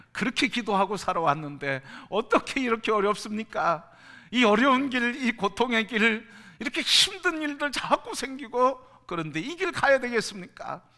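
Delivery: loud, high anguished lament; complaining prayer rising to a loud, high outcry; anguished protest to God. Retold or imitated. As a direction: cry out in protest against the hard road. Complaint prayer builds to cry.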